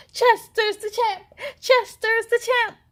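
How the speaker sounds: high crowd cheer. A narrator's reading. Crowd of cheetahs cheering for Chester. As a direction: cheer for the new winner. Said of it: "everyone cheered".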